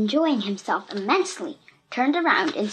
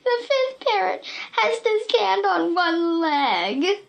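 tearful; 9 semitones higher, slower than usual. A narrator's reading. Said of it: "burst out in a flood of tears".

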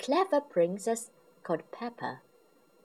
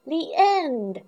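higher, brighter happy call-out; excited call; 10 semitones higher, 4 dB louder than usual. Acted. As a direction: sing it out happily to end the story. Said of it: "cries Peppa".

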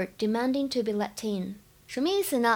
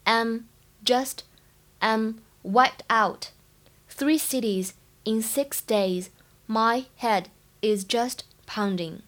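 slow, careful model reading with every sound clear; slow teaching read of "I'm wiped out"; slow demo read; slower than usual. Shown as a demonstration. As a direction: read it slowly so students can copy each sound. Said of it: "应该是读wiped，wiped是清辅音".

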